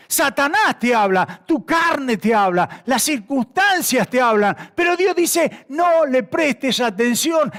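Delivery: high, slow, forceful preaching; preacher at full force, stretching the key words; impassioned preaching. Acted.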